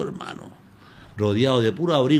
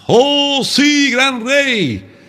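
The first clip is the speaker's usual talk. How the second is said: voice from the den; 5 semitones higher, 6 dB louder than usual. Retold or imitated. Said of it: "sale otra voz".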